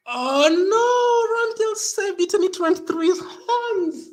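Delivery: very high, loud crying shout; anguished wail. Real, not acted. Fan seeing the save slip through, anguished wail. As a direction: wail it at a squealing pitch. Keeper nearly saved, goal went in.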